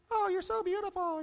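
high-pitched gushing admiration; hammed-up adoring squeal; mock fawning. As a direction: coo it high, like a smitten fan. Mimics fawning over the show.

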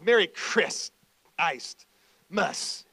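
"Merry Chris" then a pause, "iced", pause, "muss"; pause inside Christmas. Shown as a demonstration. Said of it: "And you can even pause".

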